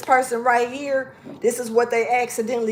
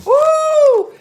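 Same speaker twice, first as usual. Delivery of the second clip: excited whoop; 8 dB louder than usual. Real, not acted. Sudden whoop during card reading.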